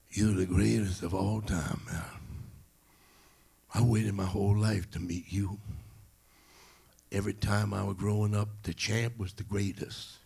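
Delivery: put-on old man's heartfelt tribute; elderly admirer, earnest and measured. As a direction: speak as an awed old fan meeting his idol. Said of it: "the old man turned to Ali and said".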